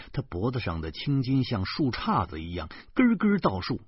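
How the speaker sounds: plain reading voice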